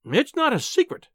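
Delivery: high, protesting wife's voice; indignant cry. A narrator's reading. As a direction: cry it out in exasperation. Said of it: "she cried".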